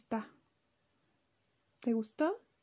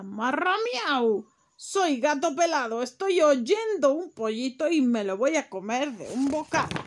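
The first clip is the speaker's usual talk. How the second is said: menacing cat; 8 dB louder than usual. Acted. Cat threatening the hidden chick, loud. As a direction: growl out a spooky cat's threat.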